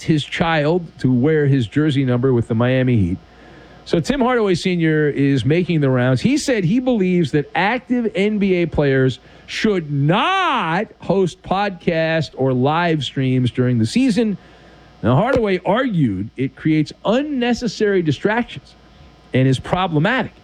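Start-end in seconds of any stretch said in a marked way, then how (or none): none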